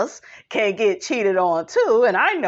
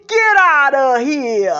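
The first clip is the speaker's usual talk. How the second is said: put-on loud, drawn-out New York accent; slow, punchy New York catchphrase; 5 dB louder than usual. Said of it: "I love her New York accent".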